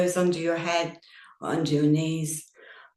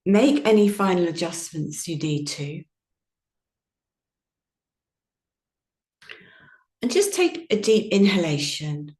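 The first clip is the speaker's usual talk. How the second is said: guided meditation. Acted. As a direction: ease the listeners gently into stillness. Guided yoga nidra meditation.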